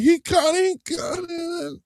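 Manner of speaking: high-pitched mock coach's cry, unsure of the catch; D'Amico, unsure. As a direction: imitate a coach's excited, doubtful high yelp. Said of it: "did y'all hear that in D'Amico voice? He ain't know for sure".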